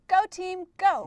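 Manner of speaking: loud, high sports cheer. Pretend. [name] cheers on her team at games, loud cheer. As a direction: shout it out like a cheerleader. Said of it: "when I get to cheer at games".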